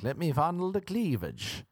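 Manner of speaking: put-on high-pitched old-fashioned come-on; old-fashioned dirty talk. Comic trying old-fashioned dirty talk, high mock-quaint come-on. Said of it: "old-fashioned dirty talk".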